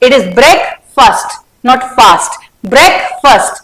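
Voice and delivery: "breakfast" said slowly as one word, "brek-fast". Shown as a demonstration. Teacher modelling "breakfast" for students, slow and joined. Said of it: "जब together बोलना है इनको".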